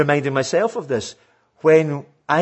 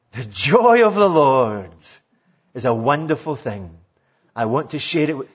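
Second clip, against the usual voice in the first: heartfelt, lively delivery; 5 semitones lower than usual. Shown as a demonstration. Showing how the preacher should have said it, joyful and expressive. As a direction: let the joy ring out with feeling. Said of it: "All I wanted him to say was".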